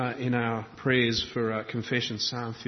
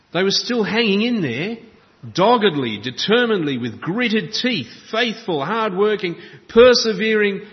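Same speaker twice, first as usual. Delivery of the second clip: louder and higher with each word, driving the list home; preaching at full force; 8 semitones higher, 9 dB louder than usual. Acted. Preacher to congregation, describing weary believers, full-force preaching.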